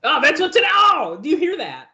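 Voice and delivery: high, loud excited shout. Real, not acted. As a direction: blurt it out in sudden excitement. Excited at the broadcast.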